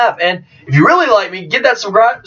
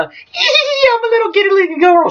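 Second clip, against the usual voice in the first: mocking giddy girl; 13 semitones higher than usual. Vlogger mocking old Harley Quinn, high girly voice. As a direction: parody a giddy little girl. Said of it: "instead of the".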